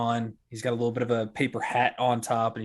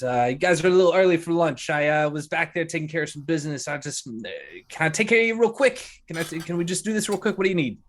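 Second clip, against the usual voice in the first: higher-pitched, flustered barkeep; harried host rushing customers along; hurried barkeep; 6 semitones higher, 4 dB louder, faster than usual. Acted. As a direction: stammer as if pulled away from business. Voices hurried barkeep Larry.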